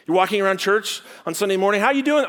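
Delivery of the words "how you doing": friendly greeting. Acted. Church greeting acted out.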